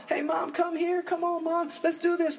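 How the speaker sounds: high, eager call across the room; calling out to mom. Retold or imitated. Eager kid calling mom to come to church, high call. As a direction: hurry your mom along, bright and high.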